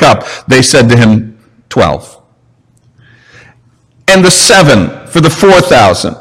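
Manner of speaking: measured, deliberate Bible reading; formal reading of a Gospel text; scripture reading. Acted. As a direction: read the passage aloud to the congregation. Reads the scripture passage aloud.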